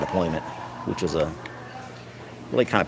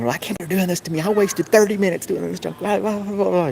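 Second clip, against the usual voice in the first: indignant coworker; 9 semitones higher than usual. Retold or imitated. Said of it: "She goes".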